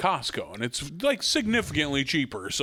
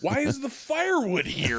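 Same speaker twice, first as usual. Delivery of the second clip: exasperated whine; 12 semitones higher than usual. Retold or imitated. Exasperated at firewood.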